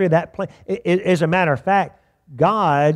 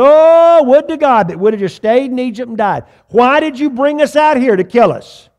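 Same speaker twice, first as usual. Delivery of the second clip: louder, higher mock wail of panicked people; Israelites crying out; 7 semitones higher, 6 dB louder than usual. Preacher voicing the Israelites at the Red Sea in a higher, louder mock wail. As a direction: ham up the frightened crowd's lament. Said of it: "they start crying out, going".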